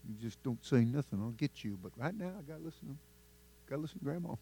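sulky muttered aside. Retold or imitated. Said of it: "he's like".

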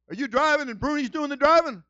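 loud, high, incredulous question; alarmed questioning. Retold or imitated. Man on the phone suspecting the learner is driving, alarmed questioning. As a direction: demand it in mock alarm.

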